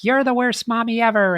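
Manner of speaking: high, quick mock child tantrum; upset little girl. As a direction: imitate a small daughter lashing out at mom. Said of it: "her little daughter would get very upset".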